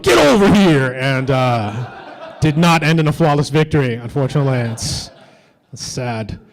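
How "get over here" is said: yelled command. Retold or imitated. Comic retelling of cops yelling back, loud mock yell. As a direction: yell it like a video game catchphrase. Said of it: "the cops yelled out".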